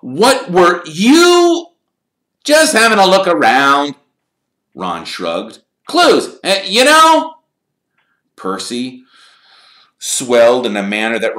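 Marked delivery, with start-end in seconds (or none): 0.0-1.7 loud, high, drawn-out question; shocked. A narrator's reading. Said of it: "an expression of complete shock". 2.4-3.9 loud, high-pitched answer back; defensive reply. Acted. Ron answers scandalized brother loudly. 5.8-7.3 very high, loud answer; defensive reply. Acted. Ron answers back to Percy.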